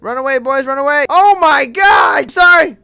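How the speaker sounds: high, very loud gamer yelling; over-the-top full-voiced panic; panicked shouting. Real, not acted. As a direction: yell in alarm as a giant attacks. Panicked yelling as boss attacks.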